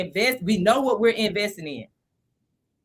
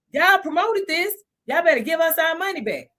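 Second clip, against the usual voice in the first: acted demand; 6 semitones higher than usual.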